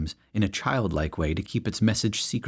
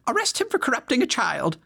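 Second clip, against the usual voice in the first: much higher, outraged cry; shrill accusation. A narrator's reading. Pious lady demanding an arrest, high outraged cry.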